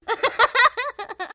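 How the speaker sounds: a quick high giggle of delight; short laugh. Shown as a demonstration. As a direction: laugh like an amused child. Story is funny, child laughs.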